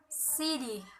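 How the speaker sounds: city, stressed on the first syllable. Shown as a demonstration. Teacher showing an example, first-syllable stress on city. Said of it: "stress on first syllables".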